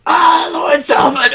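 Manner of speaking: slow, high, loud crazed shout; excited shout. Acted. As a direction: hail him like a manic fan. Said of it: "I don't know what voice to do for this random person".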